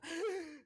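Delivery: sharp, high, loud demonstrated gasp; panic attack gasp. Shown as a demonstration. Player showing Sutton's breathing, panicked gasp. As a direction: gulp a big breath as if panicking. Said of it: "in the middle of a panic attack, they go".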